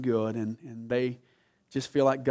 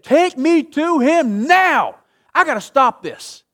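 slow, loud, much higher voice of Jesus stirred; righteous indignation; 12 semitones higher, 12 dB louder, slower than usual. Acted. Jesus at Lazarus' tomb, loud slow indignation. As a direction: demand it with slow holy anger. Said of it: "in righteous indignation, anger, said".

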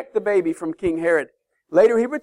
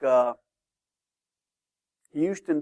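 usual speaking voice

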